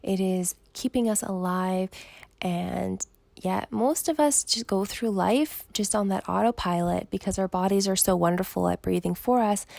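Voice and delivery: ordinary delivery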